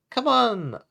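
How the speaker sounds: bright high call to a sad friend; cheery call. Acted. Octopants cheering up Pufferfish, bright loud call. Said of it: "I said to cheer him up".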